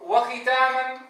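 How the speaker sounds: usual speaking voice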